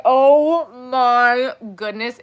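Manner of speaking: slow, loud, breathless "oh my goodness" through laughter; hysterical laughing. Retold or imitated. Recalling the spy video she showed Ben, laughing hard. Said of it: "I was hysterical".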